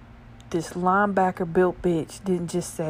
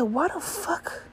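disbelieving; 10 semitones higher, slower than usual. Retold or imitated. Disbelief in the story.

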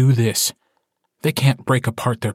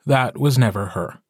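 ordinary delivery, nothing marked

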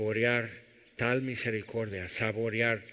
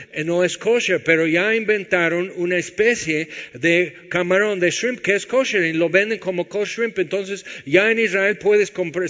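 louder, higher, driving sermon voice; a pastor preaching with rising fire; full-force preaching; 6 semitones higher, 7 dB louder than usual. Acted. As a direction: preach it hard to the congregation.

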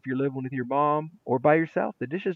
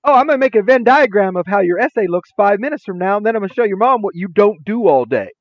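louder, higher teasing threat; mock threat; 6 semitones higher, 7 dB louder than usual. Teacher to lazy student about telling mom, mock threat. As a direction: announce the plan loudly, pitched up.

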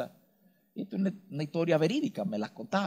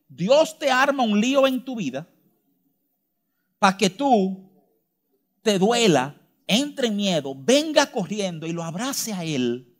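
forceful preaching; 5 semitones higher, 7 dB louder than usual. Acted.

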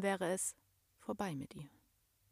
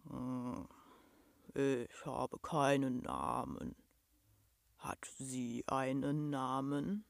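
low, slow, soft monster mumble; dejected murmur; 6 semitones lower, 4 dB quieter, slower than usual. A narrator's reading. Nameless monster asks the girl her name, dejected deep murmur. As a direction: murmur it deep and a little crushed. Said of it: "Beinahe geknickt, murmelte es".

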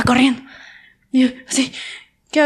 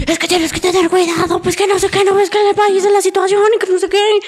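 raised, higher nagging mother voice; nagging mom; 8 semitones higher than usual. Retold or imitated. Mother lectures her daughter about safety, high and nagging. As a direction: scold like an anxious mother lecturing. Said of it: "Y mi mami".

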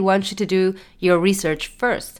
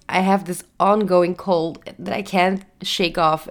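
ordinary delivery

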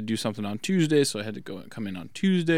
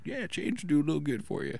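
soft, slightly higher laid-back line; lightly voiced Dude; 4 semitones higher, 7 dB quieter than usual. Retelling the ad, quiet laid-back Dude voice. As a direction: give the advice like a mellow stoner. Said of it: "and he's like".

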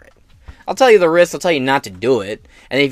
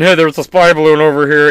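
whiny scared governor; 8 dB louder than usual. Retold or imitated. Governor phoning Biden, whining in a mock scared voice. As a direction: play a scared little man tattling.

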